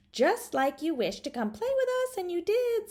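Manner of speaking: put-on doll children. Doll kids telling Charlotta she wished herself in, high and eager. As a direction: chime in brightly like toy children. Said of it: "said the doll boy and the doll girl".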